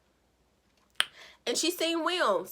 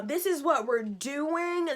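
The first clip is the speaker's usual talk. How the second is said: this is what we're doing said slowly and pointedly, higher; slow indignant; 5 semitones higher, slower than usual. Pretend. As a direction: spell out the outrage slowly. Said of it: "I said".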